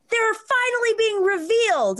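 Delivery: mock her smug satisfaction; smug woman. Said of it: "she goes".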